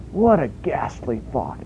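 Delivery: a hint of a British reader; light British accent on the quote. Quoting J B Phillips in a light British accent. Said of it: "J B Phillips is British, and it comes through".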